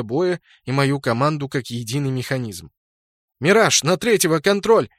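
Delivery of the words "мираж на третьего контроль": calling out a command in battle; shouted order. A narrator's reading. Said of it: "крикнул я".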